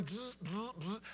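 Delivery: short imitated drill buzzes; buzzing screw-gun noise. Preacher mimics driving sheetrock screws, buzzing. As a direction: buzz in quick bursts like a power driver.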